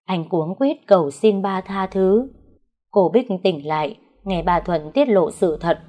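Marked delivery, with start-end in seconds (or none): none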